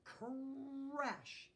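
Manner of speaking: high, drawn-out "crash"; storybook sound effect. A narrator's reading. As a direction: roll the word like a smash. Narrator voices the crash.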